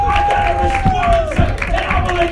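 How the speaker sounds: loud, high, shouting sermon delivery; full-force preaching. Acted. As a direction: preach it hard to the congregation.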